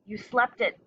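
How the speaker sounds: plain reading voice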